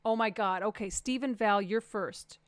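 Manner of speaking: normal talking voice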